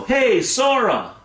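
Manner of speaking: high, slow call across the distance. Acted. Dreamer calling out to Sora in the distance, high and slow. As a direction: hail him from far off, drawn out.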